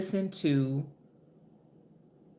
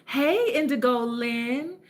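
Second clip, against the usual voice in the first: higher, slow drawn-out hey; sing-song greeting; 6 semitones higher, slower than usual. Real, not acted. Greeting a viewer on her live stream, sing-song. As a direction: call the viewer's name like a song.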